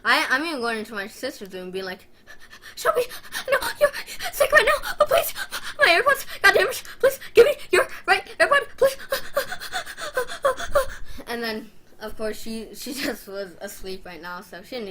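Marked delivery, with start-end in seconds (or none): 2.8-9.0 very high, loud mock sobbing plea; wailing, gasping begging to a sister; hammed-up crying. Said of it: "I'm still crying". 9.0-11.3 high-pitched exaggerated sobs; long run of gasping fake wails; mock sobbing. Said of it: "I'm still crying".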